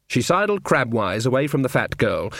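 ordinary delivery, nothing marked

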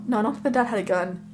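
ordinary delivery

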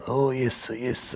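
lightly put-on Japanese accent; a hint of a mock Japanese voice. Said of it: "maybe do a Japanese voice".

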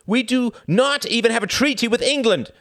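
high, loud, angry outburst; a president crying out in anger; heated cry. Acted. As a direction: fire it back heatedly. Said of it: "cried Bennis heatedly".

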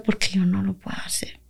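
hushed whisper of a plea; reliving a whispered prayer in tears. Retold or imitated. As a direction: breathe the prayer out without voice. Retold tearful prayer to God.

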